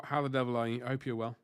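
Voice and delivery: normal talking voice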